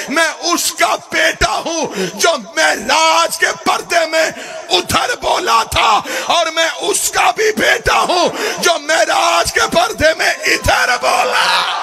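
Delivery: میں اس کا بیٹا ہوں shouted high and slow. Shown as a demonstration. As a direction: cry each word out. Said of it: "same line shouted before". (بلند پکار)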